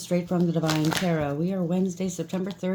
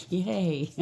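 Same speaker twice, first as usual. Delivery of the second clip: a real little laugh around a soft yay; 3 semitones higher, 3 dB quieter than usual. Real, not acted. Tarot reader laughing at her own birthday news. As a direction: laugh it off, pleased about the birthday.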